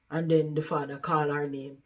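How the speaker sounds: normal talking voice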